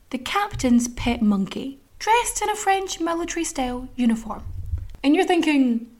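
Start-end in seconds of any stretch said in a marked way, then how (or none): none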